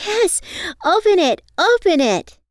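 put-on high, loud eager child voice; bouncing with excitement; excited little girl. A narrator's reading. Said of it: "Asia chimed in".

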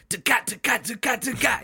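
quick, punchy vocal drumming; imitated drum beat. Fan imitating the band's drums and guitar, punchy mouth percussion. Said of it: "drums and the guitar are just, like, consistent".

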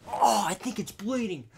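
high, loud pained cry; pained wail. Retold or imitated. Hunting buddy crying that his ear is bleeding, high and loud. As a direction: moan it out in pain.

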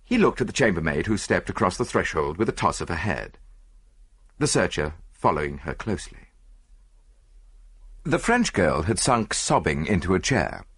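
ordinary delivery, nothing marked

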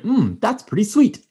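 a high mock quote of a friend tasting vodka; imitating Scott. Retelling Scott tasting the corn vodka, high mock voice. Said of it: "And he was like".

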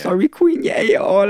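retold apology in a high voice; high, imitated sorry; high-pitched mock apology. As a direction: pitch the quoted excuse way up.

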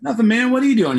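awkward and weird. Retold or imitated. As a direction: sound thrown off and uneasy. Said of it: "I just felt weird".

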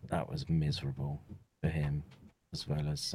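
usual speaking voice

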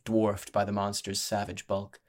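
ordinary delivery

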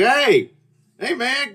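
high, loud friendly hail; calling out. Retold or imitated. Diner hails a man coming upstairs, calling out. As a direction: holler to get someone's attention.

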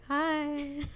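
high, small, sheepish hi; awkward tiny hi. Retold or imitated. Answering grandma after dad outed her, high and sheepish. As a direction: shrink into a timid little hi.

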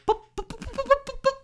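very high, loud popping voice; rapid squeaky pops, imitating popcorn; popcorn imitation. Teasing a creeped-out listener with the popcorn bit, high mock popping. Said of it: "I'll do, like, the popcorn".

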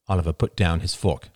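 plain reading voice

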